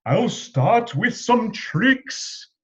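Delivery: high, slow, loud showman; showy magician. A narrator's reading. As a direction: present it with flair. Magician showing off.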